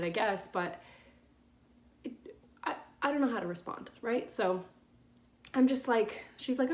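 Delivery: ordinary talk, nothing marked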